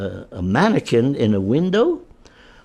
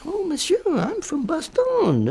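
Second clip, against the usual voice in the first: Belgian woman; 3 dB quieter, slower than usual. Retold or imitated.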